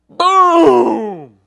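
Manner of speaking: loud, high "boom!" for a rifle shot; shouted gunshot sound; mock gunshot boom. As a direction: blast it out like a gunshot.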